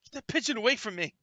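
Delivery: high, loud panicked protest; startled captive. Acted. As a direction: wake up yelping in alarm, acting it out. Captive wakes to pigeon in his face.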